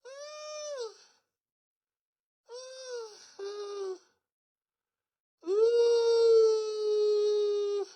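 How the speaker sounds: long, thin, very high hoots of laughter; high drawn-out helpless wail; laughing squeal. Real, not acted. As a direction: squeal softly as the laugh runs out of air. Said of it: "right now I'm laughing at you".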